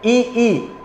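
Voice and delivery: ई held out softly, said twice; vowel demo. Shown as a demonstration. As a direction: sound out ई gently so students copy it. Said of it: "आप जैसे उच्चारण करेंगे".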